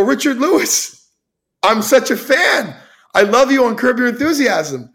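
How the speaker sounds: high, starstruck gush at a hero; gushing fan. Retold or imitated. Drunk fan in a bathroom gushing at his hero, high and excited.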